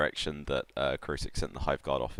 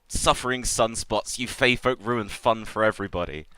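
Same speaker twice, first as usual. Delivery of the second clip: irritated; 6 semitones higher, 3 dB louder than usual. Acted. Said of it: "looking angry".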